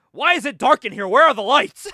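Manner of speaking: loud, high-pitched complaint; indignant yell. Retold or imitated. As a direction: act out a boss yelling about the dark. Boss yells in the dark.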